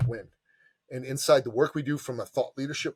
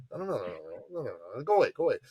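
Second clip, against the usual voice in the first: imitating dad; 4 dB quieter, much faster than usual. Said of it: "He talked like that".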